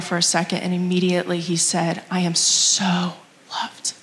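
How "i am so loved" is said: slow hushed whisper. Retold or imitated. Son's whispered answer.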